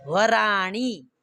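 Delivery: वराणी drawn out slowly, tongue curled back on णी; retroflex ण in वराणी. Shown as a demonstration. Teacher models the answer for students, slow retroflex वराणी. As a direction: stretch वराणी to show the retroflex ṇ. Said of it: "क्या बोलना?".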